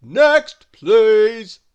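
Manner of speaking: high, loud bellowed call; greengrocer's big shout; bellowing. A narrator's reading. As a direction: bellow for the next customer. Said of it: "bellowed the greengrocer".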